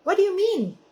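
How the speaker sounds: high, startled What? What do you mean?; mock surprise to a child; surprised. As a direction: caught off guard, voice jumps up. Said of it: "And I said".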